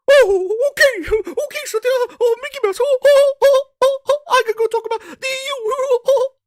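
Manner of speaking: very high, loud mock squeal of giddy Phil. Mocking Phil getting the call, in a very high squeal. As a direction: squeak out the words like an overexcited cartoon.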